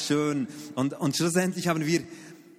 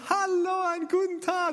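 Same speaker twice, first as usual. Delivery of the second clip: cheery wake-up call. Retold or imitated. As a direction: wake the kids with a beaming hello.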